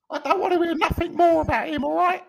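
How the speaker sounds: very high, hammed-up Cockney woman; mock Cockney wife. Wife tells husband off about English friend, high mock Cockney. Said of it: "she couldn't sound less like that".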